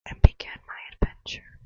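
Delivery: hushed whisper; soft unvoiced whisper. Real, not acted. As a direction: whisper it like a secret. Whispered vlog sign-off.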